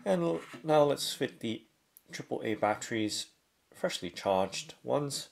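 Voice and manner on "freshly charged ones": ordinary delivery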